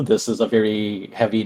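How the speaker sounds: ordinary talk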